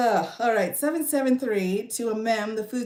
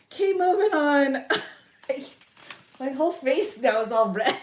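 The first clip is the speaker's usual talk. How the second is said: high, loud and breaking into giggles; laughter bubbling through the words; trying to recover from laughter; 5 semitones higher, 4 dB louder than usual. Real, not acted. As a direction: push on while still cracking up. Red-faced from laughing.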